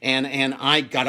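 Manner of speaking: ordinary delivery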